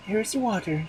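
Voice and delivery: high wife voice. A narrator's reading. Wife bringing water in a high voice.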